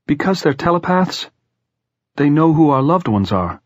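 a lightly voiced young boy, pitch rising on the question; boy Jordan, uneasy. A narrator's reading. Jordan reluctantly telling Clay why they know their loved ones, lightly voiced anxious boy. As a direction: let the boy's voice climb as he gets it out. Jordan reveals his grim idea.

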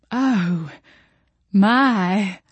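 drawn-out, wistful sigh of admiration. A narrator's reading. Expectant mother sees the baby shower decorations, sighing. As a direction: let each word out on a sigh. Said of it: "Jolene sighed".

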